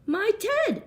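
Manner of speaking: high, gasping surprise; gasped. A narrator's reading. The bear finding his lost teddy, a high gasp. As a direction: catch your breath at finding him. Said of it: "gasped the bear".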